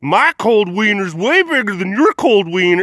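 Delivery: put-on boastful blusterer; mock one-upper. Someone bragging they are colder, mock boastful one-upper. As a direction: imitate a loudmouth outdoing everyone. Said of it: "just like".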